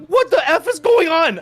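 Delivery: high, loud, slow shout; retold panicked yell. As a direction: yell it out in alarm, drawn out. Panicked yell at seizing wife.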